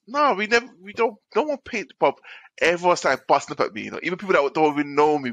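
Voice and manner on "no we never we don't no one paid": colleague. Retold or imitated. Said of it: "She goes".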